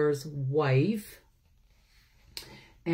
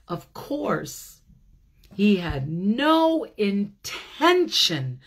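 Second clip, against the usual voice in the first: higher, lively read-aloud building to excitement; storybook reading; 7 semitones higher than usual. A narrator's reading. A teacher reads the bear story to children, rising excitement. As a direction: tell it with mounting delight as he smells honey.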